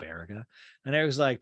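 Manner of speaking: ordinary talk, nothing marked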